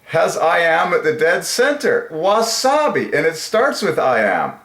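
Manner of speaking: excited. Real, not acted. Excited about the find.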